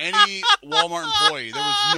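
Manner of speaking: loud high pleading cry; desperate calling out. Retold or imitated. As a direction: call out for help across the store. Desperate search retold, cries out.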